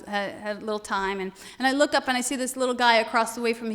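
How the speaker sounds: usual speaking voice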